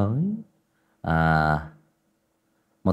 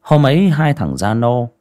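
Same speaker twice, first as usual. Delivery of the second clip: horror story narration; 5 dB louder than usual. A narrator's reading. Storyteller opening a village ghost tale, grave narration. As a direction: draw listeners into the eerie night.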